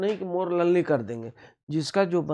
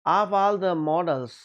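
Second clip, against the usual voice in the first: slow reading aloud; 3 semitones higher, 4 dB louder, much slower than usual. Shown as a demonstration. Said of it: "all the models की बात होगी".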